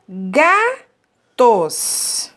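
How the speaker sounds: "gatos" split into two slow syllables; "gatos" broken apart with a clear pause. Shown as a demonstration. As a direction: say gatos in two separate beats, ga, tos. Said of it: "Nós temos dois pedacinhos".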